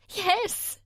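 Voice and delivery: a high, tearful little yes; sobbed yes. A narrator's reading. Sammy admitting it to Jay, tearful. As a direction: choke the word out through tears. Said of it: "Sammy sobbed".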